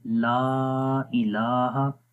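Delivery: ला इलाहा stretched very slowly, each long vowel held; tajweed recitation. Shown as a demonstration. Teacher demonstrates the first kalima to students, slow recitation. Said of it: "तो शुरू करते हैं".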